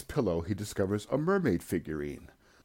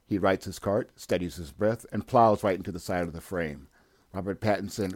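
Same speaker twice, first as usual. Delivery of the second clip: normal talking voice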